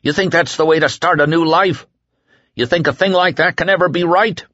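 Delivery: frantic appeal. A narrator's reading. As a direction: hammer the crowd with questions. Whitney haranguing crowd.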